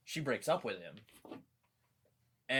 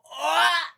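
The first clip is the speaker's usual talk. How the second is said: loud, very high alarmed shout; panicked yelp of denial; startled yelp; 14 dB louder than usual. Retold or imitated. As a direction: yelp like you've been caught. Startled by friend's offer.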